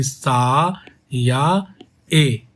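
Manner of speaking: "सा या" split and said slowly; slow syllable split. Shown as a demonstration. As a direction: break it into beats slowly. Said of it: "उच्चारण करो".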